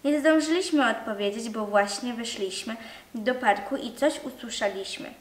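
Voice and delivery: ordinary delivery, nothing marked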